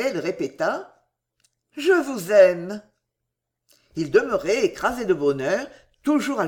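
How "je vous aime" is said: slow, loud, confident confession; assured declaration. A narrator's reading. Woman confessing her love a second time, assured. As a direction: repeat it with assurance, unhurried and firm. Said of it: "D'une voix plus assurée".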